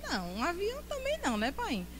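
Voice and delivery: high, soft, quick imitated reply; mock wheedling wife. Wife conceding she needs no plane, high mock wheedling. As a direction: back down quickly in a high little voice. Acted couple's quarrel about shopping.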